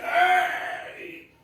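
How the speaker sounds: high-pitched cry of rising temper; exasperated cry. Acted. Preacher acting out the moment before lashing out, exasperated cry. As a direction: cry out as if about to lose it.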